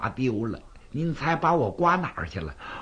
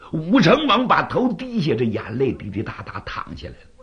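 ordinary delivery, nothing marked

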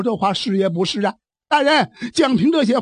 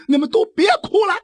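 storyteller's shouted order, pitched up high; sharp shout; 9 semitones higher than usual. Acted. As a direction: bark at the mourners to stop. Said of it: "大喊了一声".

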